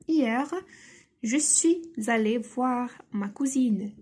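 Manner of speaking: je suis allée voir ma cousine read very slowly; slow reading aloud. Shown as a demonstration. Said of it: "reading the example slowly". (lecture lente pour les élèves)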